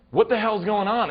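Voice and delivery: loud, high, imitated dad's yell; alarmed dad. Dad coming out of the house to the screaming, alarmed yell. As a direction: burst out as the startled father.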